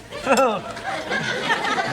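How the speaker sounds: high cackling laugh; laughing at his mate; gleeful laugh. Real, not acted. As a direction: laugh hard at him. Laughing at mate's retreat.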